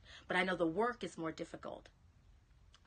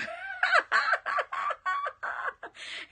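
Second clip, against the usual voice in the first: long, loud, high cackle; real uproarious laughter; cracking up; 8 dB louder than usual. Real, not acted. Cracks up over Scorpio remark.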